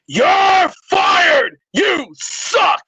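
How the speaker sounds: loud, hammed-up boss yelling you're fired; mock firing shout. Host's caller persona fires Quentin Williams with a shout. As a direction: bark it out like a furious coach axing a player.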